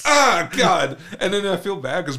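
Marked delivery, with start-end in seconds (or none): 0.0-1.0 choked exclamation. Retold or imitated. Walking into pepper spray air.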